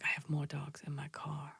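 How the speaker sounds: imitating a furtive whisper; secretive whisper. Chinese lady offering dogs from her car, whispered.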